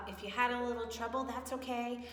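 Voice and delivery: ordinary talk, nothing marked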